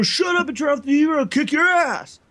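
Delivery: loud, high-pitched bullying shout; threatening brother. Retold or imitated. Bigger brother ordering him to turn off the TV, angry shout. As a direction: yell a threat like a bigger sibling. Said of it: "we always got in fights".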